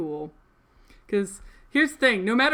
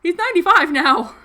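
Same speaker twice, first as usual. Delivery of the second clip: much higher and louder, laughing amazement; excited exclaim; 10 semitones higher, 12 dB louder than usual. Real, not acted. Vlogger exclaiming grandpa's age, thrilled. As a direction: blurt it out in disbelief.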